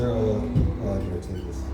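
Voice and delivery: ordinary talk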